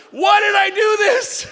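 high, slow, loud wail of regret; regretful shout. Retold or imitated. Agent regretting the lawsuit deal, slow loud wail. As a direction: cry out in regret. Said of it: "You're like".

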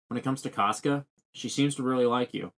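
normal talking voice

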